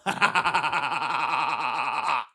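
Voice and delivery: big shouted cackle at his own prank. Real, not acted. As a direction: howl with laughter at the joke. Laughing at his own joke.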